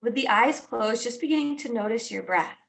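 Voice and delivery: guided meditation. Acted. Teacher guiding listeners into noticing their breath, calm guided meditation. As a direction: ease each phrase out and let it settle.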